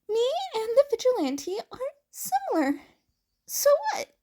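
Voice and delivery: high, fast flustered retort; defensive teen. Acted. Izuku defensively dismissing Uraraka. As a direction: brush it off too quickly.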